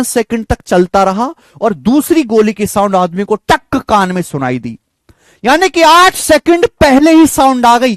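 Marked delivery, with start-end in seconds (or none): 5.4-8.0 higher and louder, punching the point home; excited reveal. Real, not acted. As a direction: announce the answer with triumph. Teacher repeats the key point. (जोश से ऊँची और तेज़ आवाज़ में)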